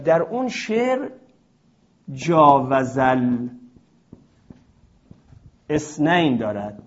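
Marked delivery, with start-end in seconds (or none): 2.1-6.4 جاوزل drawn out very slowly before اثنین; poetic reading. Shown as a demonstration. Teacher quoting the poem line جاوزل اثنین slowly. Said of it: "اونجا اگر همین قانونی".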